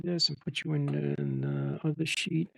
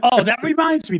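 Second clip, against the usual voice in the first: laughing; 9 semitones higher, 9 dB louder than usual. Real, not acted. Laughing after frustration remark.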